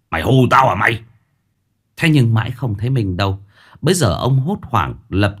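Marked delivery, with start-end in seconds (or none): 0.1-1.0 put-on loud, rough jeer from an old man; gruff drunk challenge. A narrator's reading. Drunk father jeering at his drowning son, loud and rough. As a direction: bark it at the boy. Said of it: "dằn mạnh".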